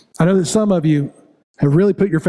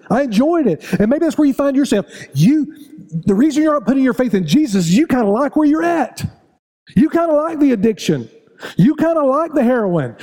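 higher, driving sermon voice, hammering each line; fiery preaching; 9 semitones higher than usual. Acted. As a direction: pound the congregation with each repeated charge. Preaching at full force.

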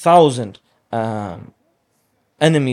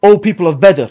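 raised, high, ringing summons; calling out; 5 semitones higher, 7 dB louder than usual. Retold or imitated. Prophet hailing the people of Badr, loud ringing call. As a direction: shame them into turning back with a loud call. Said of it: "he would call out to each clan".

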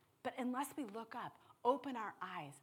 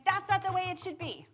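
high, loud indignant protest; 11 semitones higher, 12 dB louder than usual. Pretend. Preacher voicing the listener's outrage, indignant and loud. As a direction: protest it like injustice stings.

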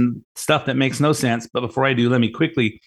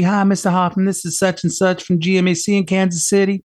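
higher, sugary customer-service lady voice; imitating GMAC lady; 7 semitones higher than usual. Radio host mimics the GMAC lady calling him, sugary and higher. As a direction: play the overly polite phone rep, pitched up. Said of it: "some call from some lady at G M A C".

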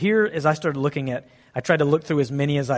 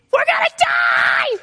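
far higher, loud slow mock scream; panicked shriek; 6 dB louder, slower than usual. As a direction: shriek it in hammed-up terror. Disciples panic in the storm.

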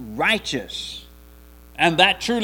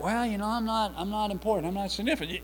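softer, modest mock self-doubt; 3 semitones higher, 8 dB quieter, faster than usual. Preacher voicing a believer who feels unimportant, softly self-doubting. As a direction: quietly play down your own worth.

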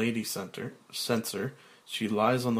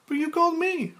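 high, fast woman's voice; Claire; 6 dB louder, faster than usual. Acted. Claire noticing Frank's change, in a high voice.

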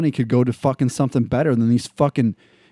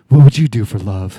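mock Natalie Portman ad line; 4 semitones lower, 3 dB louder than usual. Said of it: "smiles at the fucking screen and goes".

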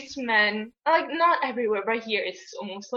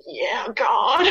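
louder, rising mock-enthusiastic cheer; mock zeal; 5 dB louder than usual. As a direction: play an eager young believer, tongue in cheek. Acting out childhood zeal for God.

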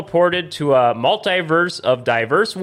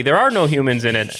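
ordinary delivery

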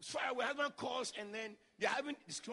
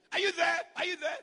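higher, louder calling down the phone; 7 semitones higher, 9 dB louder than usual. Retold or imitated. Husband on the phone calls out to his absent wife, loud and insistent. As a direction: call out to someone who isn't answering.

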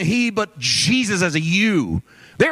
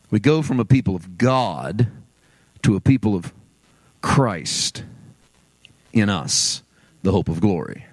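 low, deliberate phrases split by long pauses; slow and careful; 12 semitones lower than usual. Real, not acted. Preacher moving from God to Christ in us, slowly and carefully. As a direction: set down each phrase carefully, pausing before Christ. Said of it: "slowly and carefully".

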